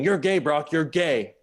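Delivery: yelling it at him, exasperated; retold shout. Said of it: "she started shouting".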